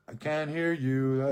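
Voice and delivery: loud, slow "I can't hear you"; mock shout. Telling the whispering man he can't hear him, loud and slow. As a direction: call it out slowly, loud. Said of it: "I'm like".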